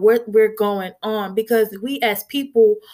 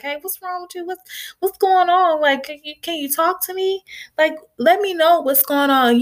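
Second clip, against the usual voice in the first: concerned friend; 6 semitones higher than usual. Retold or imitated. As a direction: lift the voice up high and ask with worried care. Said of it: "if somebody asks us, like".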